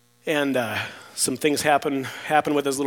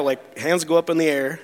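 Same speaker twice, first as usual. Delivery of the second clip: ordinary talk, nothing marked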